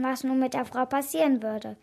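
plain reading voice

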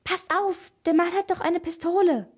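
high, alarmed warning; horrified warning. A narrator's reading. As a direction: warn your friends in horror, urgently. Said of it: "Entsetzt zischte Elena den anderen zu".

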